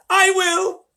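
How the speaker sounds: very high, loud eager shout. Acted. Grandpa Joe volunteering, very high loud shout. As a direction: leap in and claim it. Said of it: "shouted Grandpa Joe".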